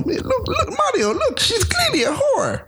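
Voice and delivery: exaggerated Italian cop impression; Italian accent. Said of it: "it's Mario".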